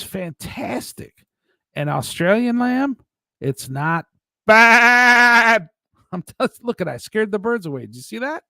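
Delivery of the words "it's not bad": loud, high sheep "baaad"; blaring, held-out sheep cry on "bad"; drawn-out bleat. Bleats the word, scares birds.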